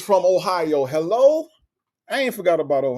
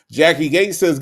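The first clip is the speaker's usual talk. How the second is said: normal talking voice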